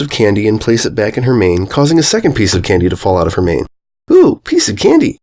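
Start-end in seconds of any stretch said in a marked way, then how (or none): 4.1-5.2 delighted ooh. Acted. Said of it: "she said".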